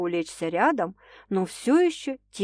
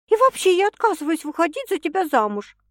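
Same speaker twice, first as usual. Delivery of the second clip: defiant refusal; 10 semitones higher, 4 dB louder than usual. A narrator's reading. Sasha refusing marriage.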